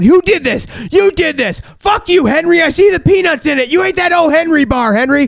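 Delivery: high, hammed-up accusing yell; mad interrogation. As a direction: replay a drunk accusing his friends in outrage. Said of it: "I'm getting mad and I'm freaking out".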